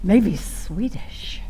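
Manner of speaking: a breathy whispered guess; hushed coy aside. Acted. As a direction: lean in and whisper it like a secret. Aside about the groomsman's looks.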